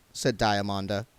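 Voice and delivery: ordinary delivery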